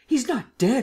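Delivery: high-pitched anxious outburst; alarmed. A narrator's reading. The Emperor fears for the fallen Lupin, alarmed. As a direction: worry pushing the voice up.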